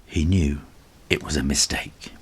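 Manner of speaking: usual speaking voice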